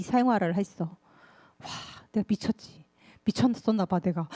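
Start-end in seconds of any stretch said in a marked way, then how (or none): none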